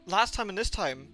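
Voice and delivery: usual speaking voice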